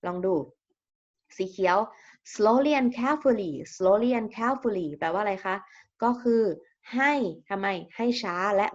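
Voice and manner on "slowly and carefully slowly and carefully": very slow, careful model reading; slow example reading. Shown as a demonstration. Teacher modelling an example phrase for the class, slowly. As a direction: say it slowly so students can copy.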